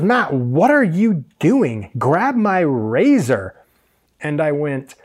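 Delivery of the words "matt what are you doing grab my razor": slow, drawn-out, higher exasperated boss; mock exasperation. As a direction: imitate a boss scolding word by word.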